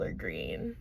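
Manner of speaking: ordinary delivery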